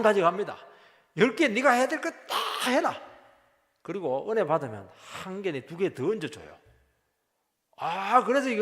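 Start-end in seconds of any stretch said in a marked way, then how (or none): 1.2-2.9 booming high command as the Lord; stern divine command. Acted. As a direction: lay down the order loudly, no excuses. God's demand quoted in sermon.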